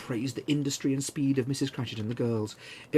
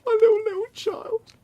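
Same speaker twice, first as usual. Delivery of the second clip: much higher, louder, voice breaking in grief; breaking cry; 9 dB louder than usual. A narrator's reading. Father to his family over his dead child, breaking. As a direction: break down on the repeated word. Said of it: "cried Bob".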